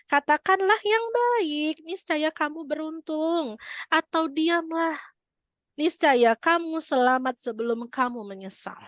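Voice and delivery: high, slow, pleading delivery; preacher pleading the meaning of the saying; impassioned preaching. Acted. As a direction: preach each clause slowly and earnestly. Translating the saying with pathos.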